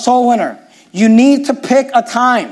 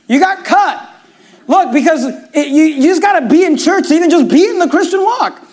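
raised, driving preacher's build; fiery preaching; 8 semitones higher than usual. Acted. Pastor urging the congregation to attend church, fiery preaching. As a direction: hammer home each point to the congregation.

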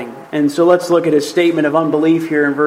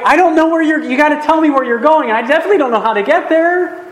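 much higher, insistent protest; worried about getting lost; 10 semitones higher, faster than usual. Acted. Thomas to Jesus, fearing he'll get lost, worried protest. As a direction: protest in a raised, hurried pitch. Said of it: "He's fearing that he's gonna get lost".

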